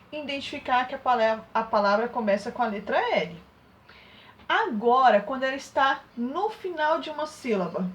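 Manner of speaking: ordinary talk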